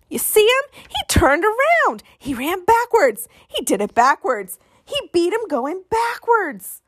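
put-on a kid stunned by the backwards win, high and slow; amazed crowd. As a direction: gasp it out in disbelief.

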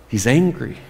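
ordinary delivery

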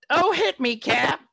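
high, loud playful cry; mock excited plea. Host jokingly begging Cap to hit them, mock excited cry. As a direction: call it out in a put-on, hammed-up beg.

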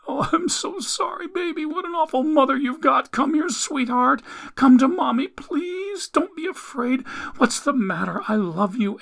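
very high, weepy coaxing; tearful mother. A narrator's reading. Mother coaxes frightened Ellie to her, tearful pleading. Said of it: "the woman dissolved into tears".

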